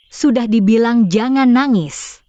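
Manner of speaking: loud, exasperated scolding; yelling at a child. Acted. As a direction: raise your voice to scold the child. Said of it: "Kenapa teriak-teriak ke anak?". (membentak anak dengan suara keras)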